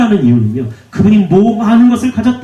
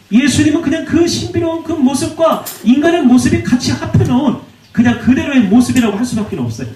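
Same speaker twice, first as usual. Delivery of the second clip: normal talking voice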